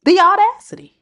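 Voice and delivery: high, loud the audacity; outraged exclaim. Real, not acted. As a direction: belt out the outrage. Exclaiming indignation.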